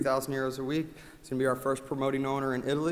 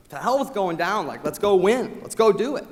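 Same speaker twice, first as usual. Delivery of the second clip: rising louder and higher into a battle cry; fired-up rally; 6 semitones higher, 7 dB louder than usual. Real, not acted. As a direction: pump him up, let's go win. Rallying a struggling teammate.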